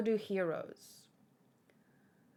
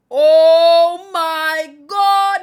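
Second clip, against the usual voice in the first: very loud, slow shocked cry; shocked shout; 3 semitones higher, 16 dB louder, much slower than usual. Real, not acted. Reader horrified at the fight, loud slow shout. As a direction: yell out in horror.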